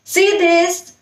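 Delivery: mother pointing something out; showing a child. Shown as a demonstration. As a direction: draw the baby's attention. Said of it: "कैसे उसको दिखा सकते हैं".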